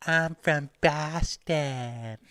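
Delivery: slow, drawn-out mock Boston accent. Mocking Rogan's old Boston accent, drawn-out and thick. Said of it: "he'd make fun of the accent".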